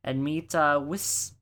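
ordinary talk, nothing marked

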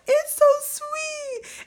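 put-on high, slow little girl's voice on the verge of tears; imitating emotional little girl. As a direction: mimic a tearful little girl. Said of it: "got so emotional that she was like".

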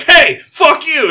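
high, loud, drawn-out comeback; mock aggressive shout. Dog answering the neighbour's dog, mock aggressive shout. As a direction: yell back as a furious dog. Dogs answering back at fence.

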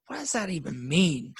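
baffled by the yoga line, he pushes back; exasperated retort. Retold or imitated. Rejecting the yoga teacher's phrase, incredulous. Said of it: "I'm like".

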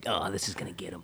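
hushed, conspiratorial prankster; sneaky whisper. Pretend. Prankster secretly texting across the funeral, sneaky whisper.

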